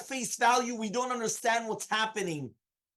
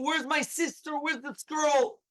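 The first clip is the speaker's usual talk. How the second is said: higher-pitched mock scream; retold scream; 5 semitones higher, slower than usual. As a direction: imitate a frantic cry without full volume. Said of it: "he started screaming".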